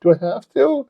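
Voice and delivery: high, mock-childish protest; reluctant whine. Husband answering his wife's order, whiny. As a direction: drag your heels, whining upward.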